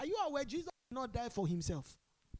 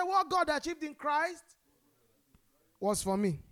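with your chest; 3 semitones higher, 6 dB louder than usual. Shown as a demonstration. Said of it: "Say it with your chest".

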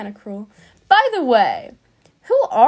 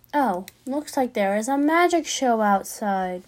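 ordinary delivery

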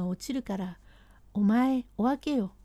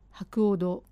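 ordinary delivery, nothing marked

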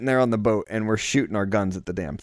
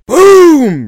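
cannon boom; 17 dB louder than usual. Retold or imitated. Cannon shot sound effect.

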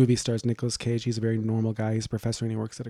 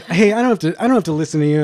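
loud retort; 6 semitones higher, 10 dB louder than usual. Retold or imitated. Acted retort to Obama in queue.

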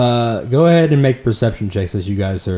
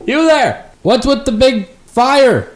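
much higher, called-out challenge; elf yelling down; 5 dB louder than usual. Acted. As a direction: call it down the hill at strangers. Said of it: "one just yells down".

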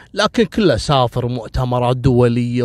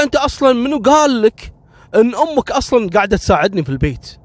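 higher, quick and dismissive; scornful wife; 7 semitones higher, faster than usual. Acted. Wife Khawla scoffing at her husband's question, higher and quick. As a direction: brush him off with a sneer.

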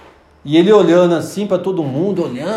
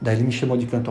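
usual speaking voice

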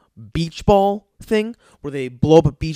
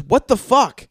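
mock angry retort; 7 semitones higher, 6 dB louder than usual. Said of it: "he looks at the guy and goes".

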